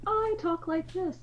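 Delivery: airy serene elf voice. Retold or imitated. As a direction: imitate a lofty elf speaking slowly. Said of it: "when I talk in my Elvish voice".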